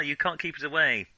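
ordinary talk

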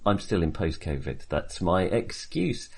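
usual speaking voice

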